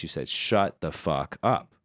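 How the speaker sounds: ordinary delivery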